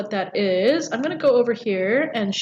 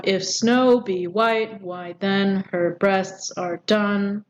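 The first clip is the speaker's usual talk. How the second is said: slow, measured verse reading; deliberate metrical recitation; iambic scansion; slower than usual. Shown as a demonstration. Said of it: "is perfect iambic pentameter".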